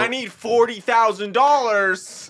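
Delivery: high, loud, slow demand; loud character voice. Acted. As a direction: belt out the demand. Repeated improv catchphrase.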